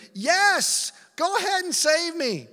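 very high, loud, impatient plea; desperate shouting. Acted. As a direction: yell to be rescued right away. Dangling man begs to be saved.

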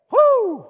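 loud, high whoop of joy; cheer. Real, not acted. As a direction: let out a celebratory woo. Cheering at peak.

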